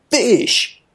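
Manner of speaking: high, falling exclamation; Cat impression. Cat ordering at the food dispenser, high-voiced call. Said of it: "he stood there and he goes".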